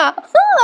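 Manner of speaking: a real, sudden high laugh; high burst of laughter. Real, not acted. Narrator laughs at the embarrassed sir, a high real laugh.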